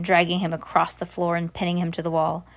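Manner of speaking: usual speaking voice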